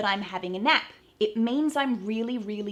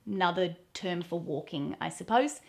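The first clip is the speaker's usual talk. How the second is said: usual speaking voice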